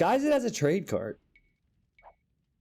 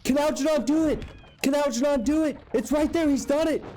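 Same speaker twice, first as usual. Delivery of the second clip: excited shout. Real, not acted. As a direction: scream it as the snipe lands. Caster calls the king snipe.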